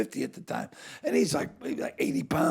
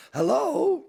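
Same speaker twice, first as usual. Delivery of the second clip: mock calling out; 11 semitones higher, 6 dB louder than usual. Mock answer to Boston accent casting.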